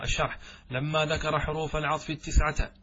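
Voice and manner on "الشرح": normal talking voice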